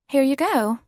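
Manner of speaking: high, bright British stewardess offering; prim British accent. Acted. Flight attendant showing the passenger her pod in a prim British accent. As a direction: hand it over with polished English cheer. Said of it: "in her prim British accent".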